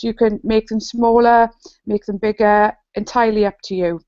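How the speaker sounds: ordinary delivery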